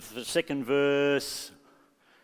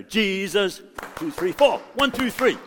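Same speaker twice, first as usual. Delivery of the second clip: counted claps; 8 semitones higher, 5 dB louder than usual. Shown as a demonstration. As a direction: call "Jesus", then count up high. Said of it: "so it's gonna".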